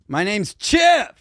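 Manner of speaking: lightly voiced game show host. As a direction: start the show like a smooth host. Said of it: "I have an all play".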